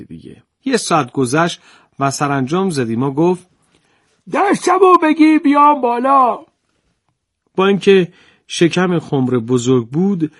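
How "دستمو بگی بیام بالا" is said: high, loud nasal-free taunt from inside the jar; cranky old jar-mender. Acted. Old mender taunts the farmer from inside the jar, high mocking voice.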